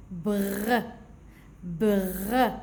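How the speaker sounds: "Br" with the R drawn out; blended consonants. Shown as a demonstration. Teacher reading syllables for children, blended consonants. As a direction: sound out "Br" as one long blend. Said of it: "regardez comment je vais lire".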